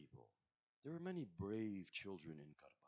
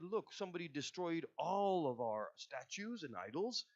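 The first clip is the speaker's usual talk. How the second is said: voiced louder and higher, outraged; angry townspeople; 6 semitones higher, 6 dB louder than usual. Townspeople confronting Ibrahim over smashed idols, outraged. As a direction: accuse him, indignant at the loss.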